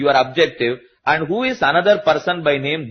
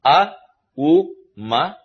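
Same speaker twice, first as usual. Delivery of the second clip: "A", "U", "Ma" set apart by pauses; syllable by syllable; 5 semitones higher, slower than usual. Shown as a demonstration. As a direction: spell out A, U, Ma as three separate sounds. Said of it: "You get three syllables".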